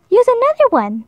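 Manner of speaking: high, chirpy bird voice; helpful birds. Acted. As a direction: pipe up like little birds. Birds advise Hippo.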